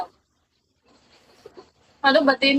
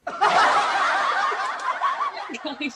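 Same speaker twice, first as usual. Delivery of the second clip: high giggle. Real, not acted. Giggling after tossing something.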